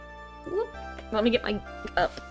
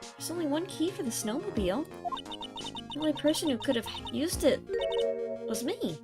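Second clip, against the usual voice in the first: higher, lilting character voice; pitched-up game witness; witness confessing; 8 semitones higher, 3 dB quieter than usual. Acted. Voicing witness answering in game.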